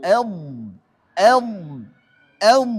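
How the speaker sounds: "Em" with a heavy held dh at its end; dark, sustained voiced close on "Em"; flowing dhad. Shown as a demonstration. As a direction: let the thick final consonant of "Em" run on. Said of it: "Apalagi bod".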